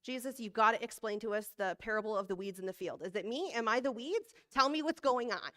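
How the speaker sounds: hammed-up anxious demand; imagined blunt disciple. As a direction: pester Jesus for an answer, building as you go. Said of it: "it might have actually sounded like this".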